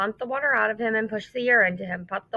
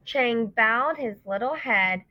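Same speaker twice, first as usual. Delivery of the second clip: plain reading voice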